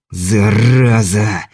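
slow drawn-out furious roar. Acted. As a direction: bellow the curse in rage. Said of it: "взревел Кобельков".

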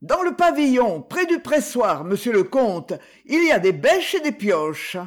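called out loud and high across the park; calling out. A narrator's reading. Old Heurtebois calling to the count, loud cry. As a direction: shout it over to the young count. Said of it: "Heurtebois lui cria".

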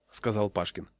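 ordinary delivery

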